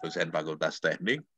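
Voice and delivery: ordinary delivery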